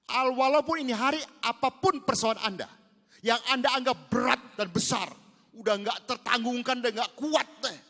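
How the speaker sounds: loud, high, slowed preaching; preaching at full force. Acted. Preacher to congregation, loud, slow and high. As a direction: press the point home to the congregation.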